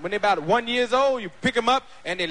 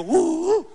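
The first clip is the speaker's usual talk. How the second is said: ecstatic moan; 7 semitones higher, 6 dB louder than usual. Retold or imitated. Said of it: "He heard you go".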